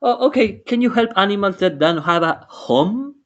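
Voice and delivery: put-on high light girl voice; girl character voice. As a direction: pitch it up like a young girl. Eliana role-played in story.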